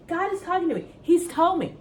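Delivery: usual speaking voice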